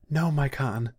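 high, loud desperate denial; urgent, high-pitched protest; panting plea. A narrator's reading. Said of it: "still panting".

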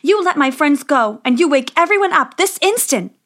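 high, forceful princess's command; indignant demand. Acted.

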